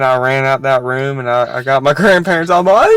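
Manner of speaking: usual speaking voice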